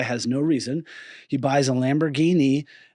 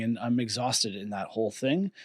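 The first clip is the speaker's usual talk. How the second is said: ordinary talk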